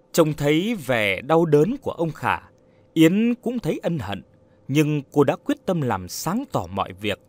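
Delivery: plain reading voice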